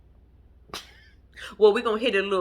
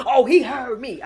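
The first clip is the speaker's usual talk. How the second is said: loud, insistent aunt impression; mock indignant retort; imitating Aunt Bertha; 2 semitones higher, 7 dB louder than usual. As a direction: push it out loud, certain of the slight. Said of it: "Aunt Bertha, are we mad".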